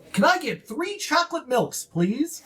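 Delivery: high, eager little gnome voice; high excited gnome; young gnome. Acted. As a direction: chirp it up like a small eager kid. Young gnome orders at bar.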